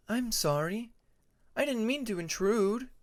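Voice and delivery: a touch of timid apology; hint of a shy Tempest.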